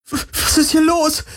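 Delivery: frightened high voice breaking; panicked, voice cracking high. Acted. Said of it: "Kirais Stimme überschlug sich".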